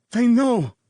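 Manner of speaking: high, loud gasping warning; wounded man gasps a warning; gasped. A narrator's reading. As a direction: force it out between gasps, dying man. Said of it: "the man gasped".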